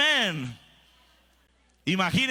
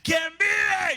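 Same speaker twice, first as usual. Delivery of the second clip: rallying cry, much higher; shouted call-out; 8 semitones higher than usual. Retold or imitated. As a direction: shout it to rouse the crowd. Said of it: "y les dije".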